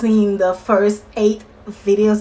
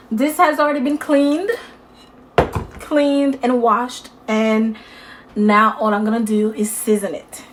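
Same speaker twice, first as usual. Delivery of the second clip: ordinary talk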